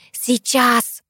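mildly yelled eager reply; girl's yell. A narrator's reading. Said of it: "завопила Кристия". (детский вопль)

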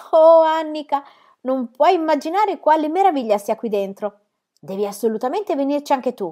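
high, loud, marvelling call; excited calling. A narrator's reading. Boy inside tree urges sister to join, excited call. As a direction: open on a long loud oh and urge them in.

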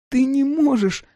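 put-on high, tearful childlike cry; sobbing plea. A narrator's reading. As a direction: cry it out through a sob. Всхлипнула и вздрогнула.

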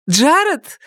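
high excited cry of a name; exclaiming. Acted. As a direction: call out the name with delight. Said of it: "воскликнула Лайла".

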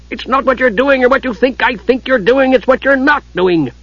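high, put-on cranky dwarf voice; exasperated dwarf. A narrator's reading. Dwarf scolds the lazy Kender, exasperated. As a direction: scold him in a worked-up dwarf voice.

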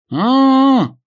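muffled hum through a gag; high muffled cry behind gag; gagged. A narrator's reading. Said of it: "пытался что-то сказать Дэн".

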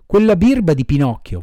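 plain reading voice

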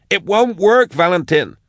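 high, desperate objection; shouted protest. A narrator's reading. Max argues with Valentin over the escape, desperate shout. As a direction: shout him down in alarm. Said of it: "she shouted".

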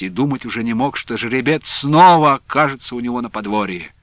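ordinary delivery, nothing marked

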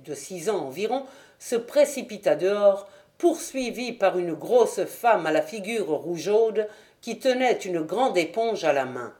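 ordinary delivery

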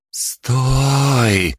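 high, long-stretched word; drawn out. A narrator's reading. Girl catches on mid-interrogation, drawling it out. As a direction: stretch the vowel out as realisation dawns. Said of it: "протянула приключенка".

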